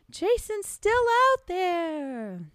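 slow, high ominous line. Acted. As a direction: let it hang with dread. Ominous ending.

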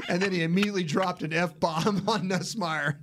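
high, laughing retelling; real laughter breaking into the words; amused laughing. Real, not acted. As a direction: tell it while cracking up. Amused retelling of mic'd-up moment.

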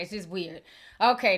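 ordinary delivery

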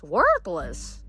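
plain reading voice

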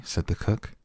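ordinary delivery

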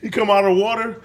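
ordinary talk

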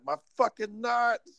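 pained cry. Retold or imitated. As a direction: relive the hit to the groin, crying out. Reliving groin hit after crash.

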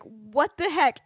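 retold shock, voice jumping up; mock shock. Lily seeing the number on her hand, acted shock.

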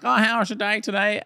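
a hint of an old wife's voice; dear old wife. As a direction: ask it as a doting elderly wife. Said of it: "his dear old wife would have been like".

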